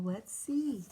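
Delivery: plain reading voice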